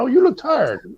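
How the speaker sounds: high, cooing imitation; mock-sweet wife. Voicing a sinister-sweet wife.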